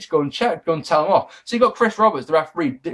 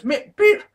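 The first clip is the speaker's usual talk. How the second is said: imitated tumble noise; 13 semitones higher than usual. Reviewer mimicking the wrestler's botched dive, high squeaky sound effect. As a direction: squeak out a little noise for a fall. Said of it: "he sort of goes".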